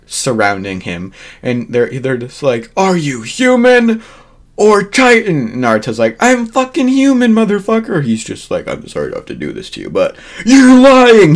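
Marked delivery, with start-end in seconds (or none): 2.8-5.5 high, drawn-out put-on challenge; mock interrogation. Garrison interrogating Naruto, acted out. 6.2-8.0 high-pitched, hammed-up protest; mock indignation. Naruto's indignant reply, acted out. 8.7-9.9 low, quick, soft put-on apology; mock apology. Garrison officer's aside before accusing. 9.9-11.3 high, loud, drawn-out accusation; mock accusing shout. Garrison officer accusing, acted out.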